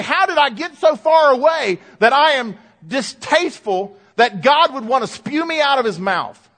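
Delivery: high, loud cry of dismay; anguished realization, pushed out to the congregation; preacher voicing sudden alarm at himself; dismayed self-questioning. Acted dismayed waking realization.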